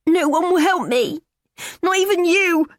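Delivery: high, loud and slow, breaking into sobs; angry tears. Acted. Daughter despairing at her mum, in angry tears. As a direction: wail it out through angry tears. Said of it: "She bursts into loud, angry tears".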